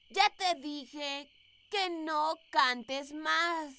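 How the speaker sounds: mumbled, soft sleep talk. Acted. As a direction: murmur it while asleep. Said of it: "¿estás hablando dormida otra vez?".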